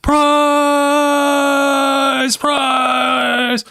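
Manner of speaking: long, high shouted goal call; Spanish goal announcer. Retold or imitated. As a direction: stretch each call out, full throat. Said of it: "the old Spanish goal announcers".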